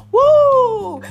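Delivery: high, loud whoop of fun; excited whoop. Real, not acted. Host skating to the left, whooping loudly. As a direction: let out a big happy whoo.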